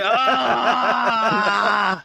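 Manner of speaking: loud, high, drawn-out scream; hammed-up cry of damage; mock scream. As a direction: scream long and high as if struck. Mock hurt, took damage.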